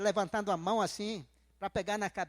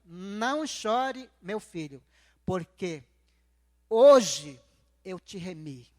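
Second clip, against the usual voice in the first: slow, solemn pronouncement with one loud burst on "hoje"; drawn-out opening, then low with long pauses; voice of God; 4 semitones lower, 5 dB louder, much slower than usual. Retold or imitated. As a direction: retell divine comfort, low and measured, punching "hoje". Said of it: "O que foi que Deus falou pra mim".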